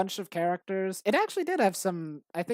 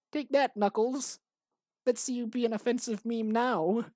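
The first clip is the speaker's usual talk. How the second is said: Kermit voice; 5 semitones higher than usual. Acted. Acting Kermit cracking Knuckles.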